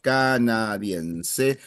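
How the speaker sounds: "Canadiense" said very slowly, rising at the end. Shown as a demonstration. Teacher repeating the student's word very slowly. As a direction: spell out "Canadiense" syllable by syllable. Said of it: "nationality would be number two".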